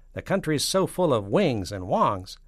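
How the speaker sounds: loud, swooping, emphatic voice; punchline delivery. Acted. Postmaster general excusing the phone mix-up, emphatic punchline. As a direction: build loudly to the punchline.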